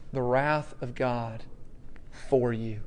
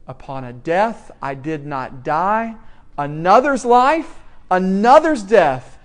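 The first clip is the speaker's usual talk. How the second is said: building recitation; 6 dB louder than usual. Shown as a demonstration. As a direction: start measured, then proclaim "another's life, another's death". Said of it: "led John Newton to write".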